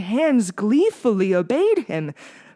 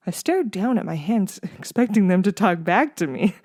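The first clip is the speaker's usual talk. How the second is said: plain reading voice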